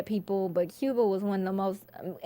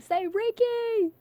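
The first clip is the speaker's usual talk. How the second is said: high, drawn-out call; mimicked friend's sing-out; imitated friend's prompt; 13 semitones higher, much slower than usual. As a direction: stretch it out high, egging someone on. Imitates friend egging her on.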